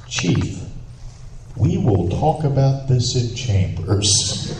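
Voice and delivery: weighty, drawn-out courtroom rebuke; stern judge. Retold or imitated. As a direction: imitate a judge warning, every word stretched. Judge sternly summons police chief.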